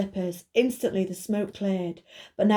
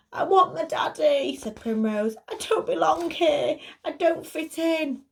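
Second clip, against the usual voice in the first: put-on upset child; 8 semitones higher than usual. Giant girl wants to go home.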